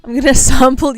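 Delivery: usual speaking voice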